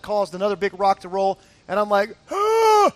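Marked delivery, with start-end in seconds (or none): 2.3-2.9 high, loud gasp of alarm; sudden high cry of shock; startled yelp. Retold or imitated. Hiker as a rock rolls toward him, startled yelp.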